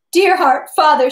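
high, loud imploring cry. A narrator's reading. Woman seizing Pierre by the legs, desperate cry. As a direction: call out in desperate appeal. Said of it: "she cried, seizing him by the legs".

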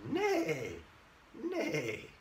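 drawn-out neigh with a falling tune; playful whinnying neigh; horse imitation. Shown as a demonstration. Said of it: "what animal says".

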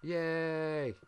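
high, loud triumphant yay; joyful cheer. Real, not acted. Build finally works.

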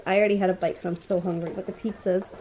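ordinary delivery

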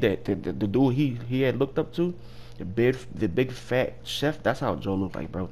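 normal talking voice

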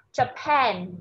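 "Japan" as ja-PAN; stress on second syllable. Shown as a demonstration. Teacher saying "listen", "Japan" stressed on PAN. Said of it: "là nhấn âm thứ nhì nha".

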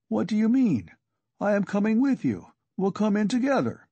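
high, urgent protest; insistent. A narrator's reading. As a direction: refuse to leave him alone. Razumian protests, insists on coming.

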